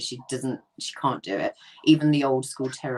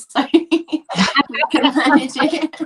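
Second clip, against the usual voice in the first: laughing through the words; 12 semitones higher, 10 dB louder than usual. Real, not acted. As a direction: giggle it out, higher. Laughing about cloning herself.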